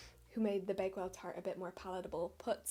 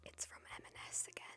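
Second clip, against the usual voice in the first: whispered aside; 11 dB quieter, faster than usual. Real, not acted.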